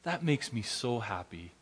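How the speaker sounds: quiet calming dad. Retold or imitated. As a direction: soothe her softly like the adult. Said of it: "calm myself down".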